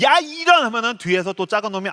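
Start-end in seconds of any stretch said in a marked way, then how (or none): 0.0-0.6 high, loud road-rage yell; harsh outburst. Retold or imitated. Father swearing at another driver, a sudden loud yell. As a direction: snap at the other driver. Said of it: "말을 좀 세게".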